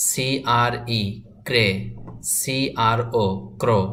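সিআরএ ক্রা spelled letter by letter, then ক্রা said as one blended sound; slow spelled-out pronunciation drill. Shown as a demonstration. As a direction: spell each letter slowly, then snap the cluster together. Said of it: "দেখুন আমি কিভাবে পড়ছি।". (ধীরে বানান করে তারপর একসাথে উচ্চারণ)

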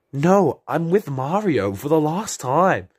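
loud, high, slow, emphatic no; exasperated refusal. Acted.

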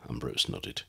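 usual speaking voice

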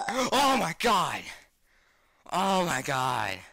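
gasp, then loud drawn-out shock; shriek, then come down still loud; real jump-scare fright; startled scream. Real, not acted.

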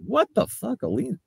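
shocked disbelief. Retold or imitated. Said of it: "I was just like".

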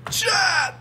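high call to the chat. Real, not acted. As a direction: sing out to the chat. Calling out to stream chat.

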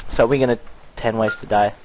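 ordinary talk, nothing marked

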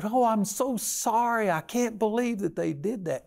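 high, fluttery mock apology; apologetic pet owner. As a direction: play the flustered owner saying sorry. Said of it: "the people will just apologize".

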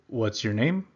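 name lifted up at the end; rising question. Shown as a demonstration. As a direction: ask it with a rise on name. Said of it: "this in English".

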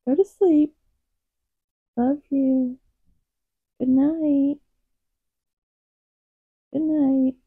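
high, softening pet-talk lullaby manner; cooing goodnight. Real, not acted. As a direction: coo the cat to sleep, high and gentle. Cooing the cat to sleep.

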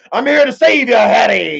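big, high, loud Hagrid rescue cry; Hagrid impression. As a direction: boom it out like Hagrid at the door. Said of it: "like Hagrid when he come to".

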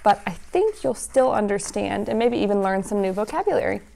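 plain reading voice

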